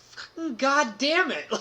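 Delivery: cursing while laughing; laughing through quote. Real, not acted. Retelling friend's defeat, laughing through the curse. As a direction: giggle through the friend's swearing.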